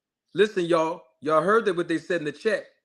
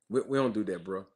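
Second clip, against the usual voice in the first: low, soft scolding; 7 semitones lower, 3 dB quieter than usual. Real, not acted. Scolds commenter for his remark.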